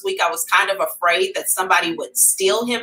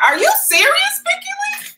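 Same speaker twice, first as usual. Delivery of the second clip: very high disbelieving squeal; incredulous squeal; 3 dB louder than usual. Real, not acted. Squealing at a viewer named Vicky Lee, incredulous.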